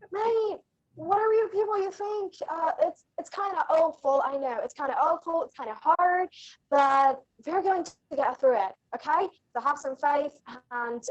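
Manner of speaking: a light Canadian lilt, gentle and kind; Canadian accent. Shown as a demonstration.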